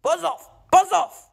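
short hissed warning, not shouted. A narrator's reading. James tells the bees to go, hissing. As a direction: hiss it to shoo them away. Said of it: "he hissed".

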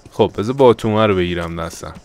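usual speaking voice

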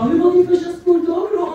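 high, loud mock mother calling out; mom's greeting. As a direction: play mom shouting a cheery question. Mother greets him after school.

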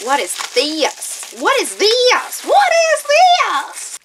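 slow question climbing to a high, loud squeal; hammed-up amazement. Vlogger puzzling over a package item, building mock amazement. Comic repeated question, escalating.

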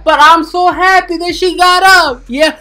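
high, loud happy outburst; excited. Pretend. Host cheers to viewers that the girl woke, high loud excitement.

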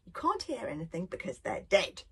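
plain reading voice

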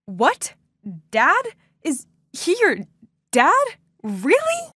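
stunned, the last Really? leaps way up; stammering in disbelief. Retold or imitated. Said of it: "I stammered".

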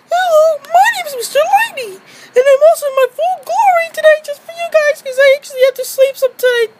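very high put-on toy voice; Mister Lady voice. Mister Lady greets the viewers, very high. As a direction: squeak it like a tiny toy.

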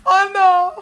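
high, loud laughing cry; real laughing fit; overcome with laughter. Real, not acted. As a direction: wail it through helpless laughter.

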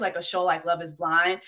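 normal talking voice

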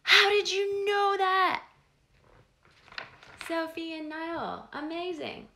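high, loud astonished cry; amazed. Real, not acted. Marvelling at the children's answer, loud and amazed. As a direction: gasp and exclaim in delight.

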